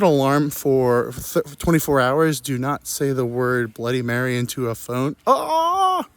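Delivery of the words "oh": ordinary talk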